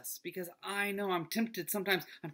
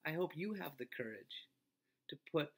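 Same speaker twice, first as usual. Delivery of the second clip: ordinary talk, nothing marked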